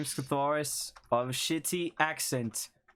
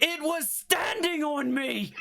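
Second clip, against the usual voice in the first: high whiny complaint; 12 semitones higher, slower than usual. Acted. Catharus complains in character voice.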